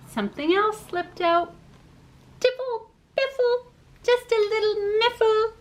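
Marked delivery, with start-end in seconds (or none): 2.4-5.5 high playful silly chant; giddy nonsense rhyme. Acted. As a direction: bubble over with silliness.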